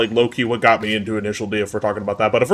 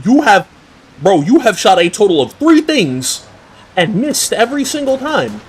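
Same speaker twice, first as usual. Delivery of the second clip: exasperated outburst; 10 semitones higher, 3 dB louder than usual. Real, not acted. Exasperated at game character's taunt.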